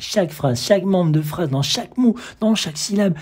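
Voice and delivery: building excitement. Acted. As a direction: build each item up with mounting enthusiasm.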